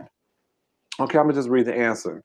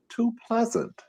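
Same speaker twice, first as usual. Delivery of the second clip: "too pleasant" said high and soft, a mock-sweet aside; quoting the director; 11 semitones higher, 5 dB quieter than usual. Host quoting the director's verdict on O J, mock-sweet and high. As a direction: lightly mimic a fussy director, much higher.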